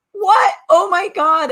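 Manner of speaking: high, loud shocked gasp of disbelief; retold shock at a character's death; shocked outburst. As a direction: blurt it out as if stunned by the TV. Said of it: "and I was like".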